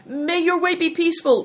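high, loud farewell call; cried out. A narrator's reading. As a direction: call the blessing out after them. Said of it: "cried Bator".

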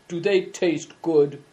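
slow, rough stranger's question; a lightly roughened stranger asking slowly. A narrator's reading. Stranger eyeing two boys, asks slowly and a touch rough. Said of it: "a rough voice asked suddenly".

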